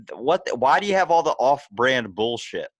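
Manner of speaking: worked-up mock complaint; retold indignation. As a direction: re-enact being indignantly baffled. Retold indignant reaction to cereal names.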